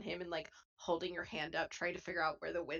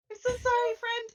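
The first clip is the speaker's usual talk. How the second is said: much higher, louder, laughing apology; flustered Chip; 9 dB louder than usual. Acted. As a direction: gasp out sorry in a rush. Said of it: "she's like, gasps".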